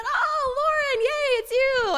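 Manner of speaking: very high, loud delighted squeal; excited pastor. Retold or imitated. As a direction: burst out with joy at her. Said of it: "Pastor Jim was so excited".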